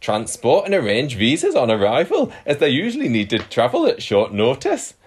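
amateur Geordie accent, slightly laughing. Shown as a demonstration. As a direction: read the quote in a hammed-up Geordie accent. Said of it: "He didn't say like or pet".

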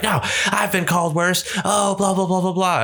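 high-pitched mock indignant retort; imitated old lady. Seventy-year-old wife answering her husband, mock indignant. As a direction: mimic a worked-up woman, voice pitched well up. Voices old woman arguing back.

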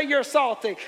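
loud pointed call-out; loud finger-pointing accusation; shouting. Shown as a demonstration. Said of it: "Point at him".

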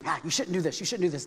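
low, very soft needling; nagging accuser. Retold or imitated. Devil accusing over and over, nagging. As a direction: accuse under your voice, over and over. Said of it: "the devil keeps accusing over and over".

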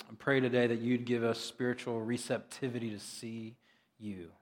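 softly spoken pastoral prayer; public prayer. Real, not acted. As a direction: pray gently, dropping soft at phrase ends. Let me pray for us.

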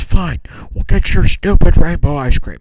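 imitated dad yelling; retold angry yell. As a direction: snap loudly as a fed-up father. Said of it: "he yelled at me".

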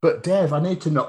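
pleading underling. Acted.

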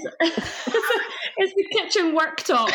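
high, slow laughing words. Real, not acted. Laughing at the bunker mix-up.

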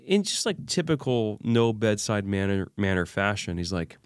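normal talking voice

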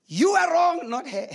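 loud, high-pitched imitation of God scolding; mock shouted rebuke. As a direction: burst out with a comic telling-off. God's rebuke voiced in a story.

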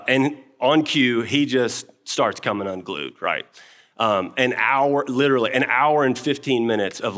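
ordinary talk

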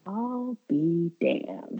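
slow, quiet stunned muttering; mild aside. Retold or imitated. Producer imitated in a slow stunned murmur. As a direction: let it out slowly in disbelief. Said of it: "the short redheaded lady being like".

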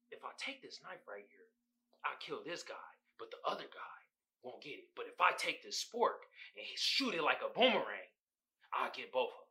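low, muttered mock action-hero plan; mock movie-hero musing. Imitating Denzel scheming quietly. Retelling Denzel's thought about sporks.

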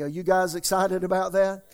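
ordinary delivery

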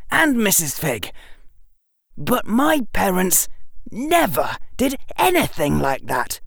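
high-pitched indignant outburst; shouted. A narrator's reading. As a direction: protest in outrage, stressing the key words. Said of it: "Harry shouted".

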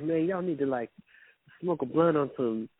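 impression of the delivery guy telling them to chill. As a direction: ham up a skinny dude faking slang.

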